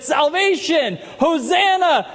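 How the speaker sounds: mock crowd shouting hosanna; crowd's joyful cry. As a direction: cry it out like the Palm Sunday crowd. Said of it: "they said".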